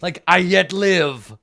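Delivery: loud, high, drawn-out shout; defiant shout. Acted. Game master as enemy leader refuting a death claim, shouting. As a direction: bellow it slowly as the leader declaring himself alive. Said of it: "[name] and C shouts".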